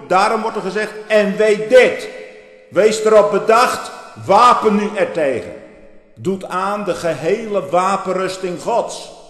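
high, loud call to take up arms; thundering preaching. Acted. Preacher rousing the congregation to arm themselves, loud and high. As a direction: preach it out loud as a stern warning.

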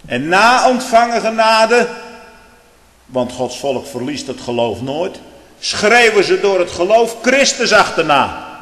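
loud, high pulpit voice; fiery preaching. Acted. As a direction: thunder it from the pulpit. Preaching at full force. (vurig preken)

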